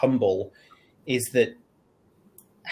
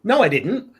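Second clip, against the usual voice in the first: quick, higher, louder brush-off denial; defensive denial; 8 semitones higher, 7 dB louder than usual. Retold or imitated. Leader rejecting a colleague's criticism, defensive and higher. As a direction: snap back as if caught out. Alex flatly denying the feedback.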